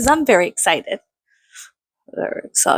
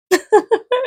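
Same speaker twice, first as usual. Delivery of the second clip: high rising giggle; 12 semitones higher than usual. Pretend. Acting the giggle after end scene. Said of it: "and I'm just like".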